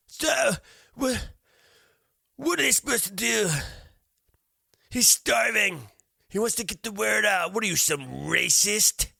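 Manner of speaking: high, loud nagging mockery; mock opponent. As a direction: whine it high and accusing. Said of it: "she writes back".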